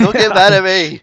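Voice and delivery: loud, high-pitched playful plea; mock protest. Host to guest after admitting dozing off, loud mock protest. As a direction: plead noisily in mock defence.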